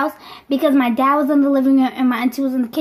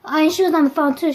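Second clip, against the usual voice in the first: ordinary talk, nothing marked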